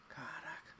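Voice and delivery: breathy whispered curse. Real, not acted. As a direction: hiss it under your breath. Under breath.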